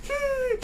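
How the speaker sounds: put-on a child's content hum; pleased little hum. Girlfriend cheered up by the new fish, pleased high hum. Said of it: "She started smiling".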